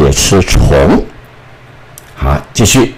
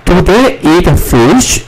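high, slow questioning read; slow model question; 9 semitones higher than usual. Shown as a demonstration. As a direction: ask it slowly for the class. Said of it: "第三个". (高音慢速示范问句)

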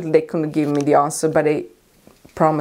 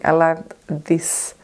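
ordinary talk

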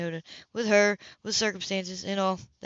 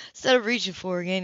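usual speaking voice

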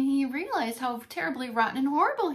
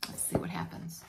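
whisper; 7 dB quieter than usual. Real, not acted. Reader's hushed aside to listener.

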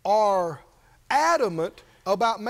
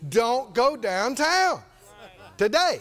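each word drawn out and weighted; slow emphatic warning; slower than usual. Acted. Holy Ghost telling the believer once more, slow and emphatic. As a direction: spell it out slowly, insistently.

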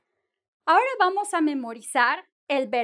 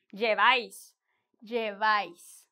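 lleváis with the stress on the final -váis; teacher's careful lleváis, leaning on the á; 5 semitones lower than usual. Shown as a demonstration. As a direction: say lleváis low and measured, accenting the á. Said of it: "Con acento en la A".

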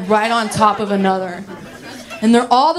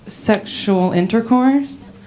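ordinary talk

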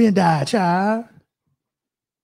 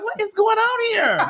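bewildered [name]; 11 semitones higher than usual. Retold or imitated. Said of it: "[name] was like".

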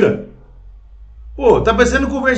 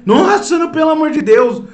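high, far-off mock-horrified voice, sharply raised; mock shocked outrage; 7 semitones higher than usual. Ranting vlogger mocks feminists' outrage, high mock-shocked voice. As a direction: parody the scandalized protest with a high gasp.

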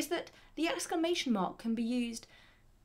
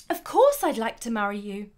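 loud, emphatic joyful acceptance; dramatic, passionate; 8 dB louder than usual. Acted. Someone accepting a marriage proposal, dramatic and passionate. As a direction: exclaim a passionate definite yes. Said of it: "sounds very dramatic and very passionate".

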